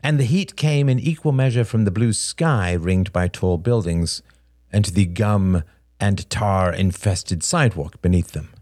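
ordinary delivery, nothing marked

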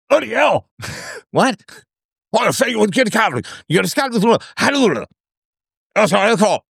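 put-on high, hammy British accent; mock a thick British accent. Mocking the caller's British accent.

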